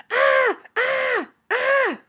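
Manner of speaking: three loud, high screams. A narrator's reading. Child clown circling trick-or-treaters to scare them, screaming. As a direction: scream "Ah!" in sharp bursts. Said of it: "I screamed".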